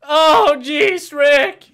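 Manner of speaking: very loud, high fretful cry; blown-up cartoon whine; Morty. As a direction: holler it high, word by word. Both imitate Morty.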